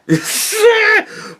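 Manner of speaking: squeaky high laugh breaking out; high burst of laughter. Real, not acted. Laughing at his own joke about the party ban, high giggle. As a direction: crack up in a high-pitched laugh.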